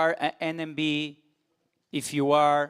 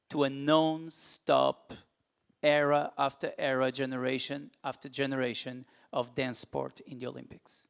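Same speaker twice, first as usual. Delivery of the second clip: solemn speech. Acted. Slow rousing closing lines.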